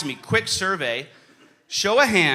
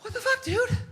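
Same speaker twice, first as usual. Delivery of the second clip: very high, small incredulous voice; exasperated squeak. Retold or imitated. Player sits down after getting no answer, squeaky exasperation. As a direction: squeak it in spent disbelief.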